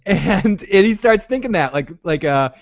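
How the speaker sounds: ordinary talk, nothing marked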